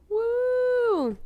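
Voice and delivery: high excited whoop; cheering woo. Real, not acted. Cheering about watching Hannibal, high excited woo. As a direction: let out a happy cheer.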